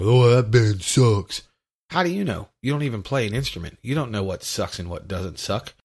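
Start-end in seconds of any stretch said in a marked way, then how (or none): 0.0-1.4 dismissive snob. Retold or imitated. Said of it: "look at a song or a band and go".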